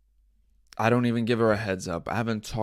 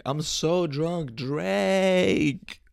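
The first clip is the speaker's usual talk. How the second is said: slow, high mock drunk call; put-on sloppy drunk pleading; drunk thot tweet; 7 semitones higher, much slower than usual. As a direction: stretch it out, climbing on the name. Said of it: "Somebody just was drunk as hell, just tweeting".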